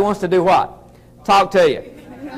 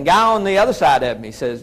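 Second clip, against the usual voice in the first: ordinary talk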